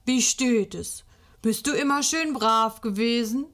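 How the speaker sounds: a hint of a stern Saint Nicholas; mock Nikolaus. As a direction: question her like a playful stern Nikolaus. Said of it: "brummt Schnüpperle".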